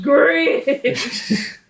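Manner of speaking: loud announcer greeting. Shown as a demonstration. Mock announcer echo.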